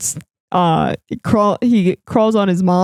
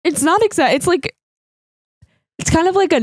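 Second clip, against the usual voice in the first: excited; 8 semitones higher, faster than usual. Retold or imitated. Reacting to the film.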